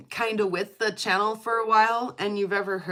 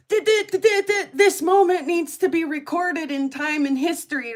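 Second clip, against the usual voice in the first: higher, louder, stuttering over the start; excited stammer; 7 semitones higher, 6 dB louder than usual. Retold or imitated. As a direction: trip over the words with excitement. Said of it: "I'm like".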